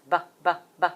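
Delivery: short repeated buh sounds; letter sound B. Shown as a demonstration. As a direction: sound out B, B, B crisply. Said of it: "B's sound like this".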